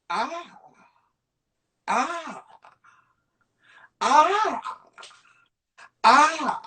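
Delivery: rising strained grunts. Retold or imitated. Imitating Lee drawing two-handed.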